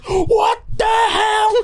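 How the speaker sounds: very high, drawn-out mock scream; shocked shriek. Donna sees the doll react, shocked shriek. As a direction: shriek it slowly in horrified disbelief.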